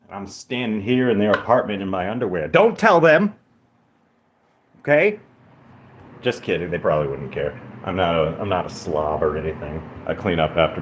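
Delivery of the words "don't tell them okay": mock plea. Joking plea to viewers.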